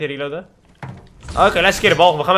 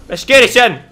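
hyped shout; 7 semitones higher, 8 dB louder, slower than usual. Real, not acted.

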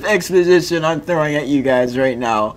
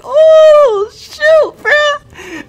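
high-pitched yelp of shock; 5 dB louder, much slower than usual. Real, not acted. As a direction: squeal it out in disbelief. Reacting to chat, laughing hard.

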